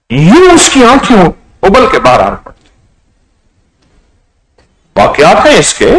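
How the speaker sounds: normal talking voice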